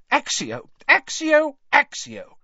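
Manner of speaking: loud spell cries, leaping high on the second; shouted. A narrator's reading. Mother summoning hidden toffees from the twins, shouting. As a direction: fire out each command forcefully. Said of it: "she shouted".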